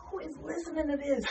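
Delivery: high-pitched baffled questioning; mock disbelief. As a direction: ask in comic dismay at your own voice. Mock dismay at own recorded voice.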